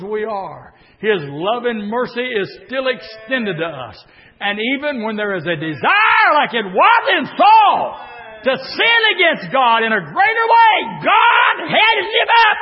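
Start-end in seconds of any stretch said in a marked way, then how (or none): 4.4-12.6 shouted, high preaching cadence; preaching at full force. Acted. Preacher shouting to the congregation, full force. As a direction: preach it out to the church.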